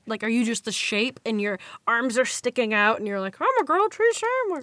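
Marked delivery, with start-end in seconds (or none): 3.4-4.6 high, fast silly voice as a sandwich; mock costume voice. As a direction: squeak it out like a proud sandwich. Mocking imagined costume quote.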